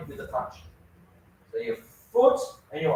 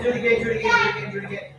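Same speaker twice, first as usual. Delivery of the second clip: eager urging; 14 semitones higher, much faster than usual. Real, not acted. Coach urging repeats.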